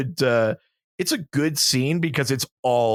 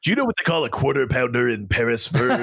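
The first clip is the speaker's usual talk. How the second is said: Pulp Fiction quote; 4 semitones higher than usual. Retold or imitated. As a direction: lay the movie line on thick. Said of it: "That was good".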